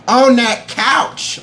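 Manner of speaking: high, drawn-out odd voice; silly put-on voice. Viewer who almost cried on that couch, silly put-on voice. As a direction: stretch it out slowly in a high comic voice. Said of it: "where these voices are coming from".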